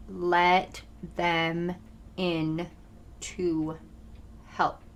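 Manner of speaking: slow, softening word-by-word delivery; very slow, emphatic. Real, not acted. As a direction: space out every word for emphasis. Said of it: "talk really slow there and very much emphasize".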